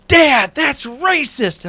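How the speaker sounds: put-on high, slow childlike protest; imitating my kids. Kids scolding their dad in the store, high and slow. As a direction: mimic the kids calling dad out. Kids voiced in grocery story.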